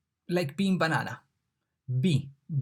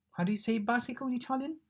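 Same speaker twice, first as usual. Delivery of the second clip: quizzing; 9 semitones higher, 3 dB quieter, faster than usual. Acted. Asking the class.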